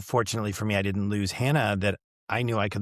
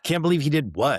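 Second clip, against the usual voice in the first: retold surprise, pitched up a little louder; surprised question; 5 semitones higher, 3 dB louder than usual. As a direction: play back his own startled confusion. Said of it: "And I'm like".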